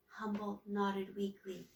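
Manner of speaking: ordinary delivery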